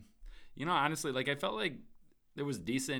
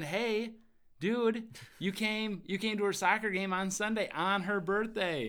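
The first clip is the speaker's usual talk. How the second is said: high, stretched-out mock greeting; playful high hey dude; 7 semitones higher than usual. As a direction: hail him like a buddy at the game. Mock-greeting dad at soccer game.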